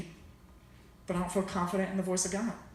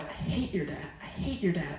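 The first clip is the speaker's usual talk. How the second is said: hushed, unvoiced and lower; whispered grumble; 4 semitones lower, 5 dB quieter than usual. Boy whispering to his friend about his dad, hushed grumble. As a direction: grumble it under your breath while running. Runners muttering during race.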